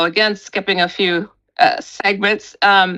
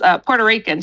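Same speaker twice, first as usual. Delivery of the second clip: normal talking voice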